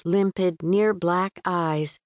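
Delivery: ordinary delivery, nothing marked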